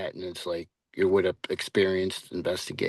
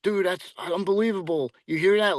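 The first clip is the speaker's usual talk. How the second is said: excited whoa; 10 semitones higher than usual. Retold or imitated. Hikers hearing strange noise at night.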